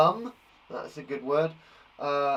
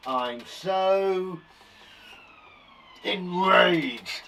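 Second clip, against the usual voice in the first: higher, louder hammed-up rage; mock enraged; 5 semitones higher, 5 dB louder, much slower than usual. Shown as a demonstration. Teacher acting out synonyms for angry, mock enraged. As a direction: build to a pretend enraged outburst.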